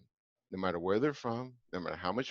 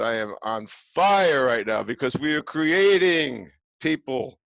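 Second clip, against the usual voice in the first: fired up; 4 semitones higher, 8 dB louder, slower than usual. Real, not acted. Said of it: "don't get me started".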